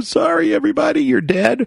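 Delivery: hammed-up pretend breakdown, pitch way up; mock crying. Listener facing the billionaire's test, mock crying apology. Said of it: "Would you start crying".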